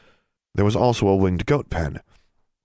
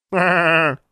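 high, loud imitated bleat; loud goat bleat; 10 semitones higher, 5 dB louder than usual. A narrator's reading. Goat butting the student from behind, loud bleat. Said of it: "with a loud".

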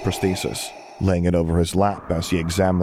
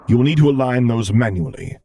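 louder, commanding voice; Vader voice; 5 semitones higher, 5 dB louder than usual. Acted. Vader instructing Luke, in a louder commanding voice. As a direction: speak as the stern dark lord explaining.

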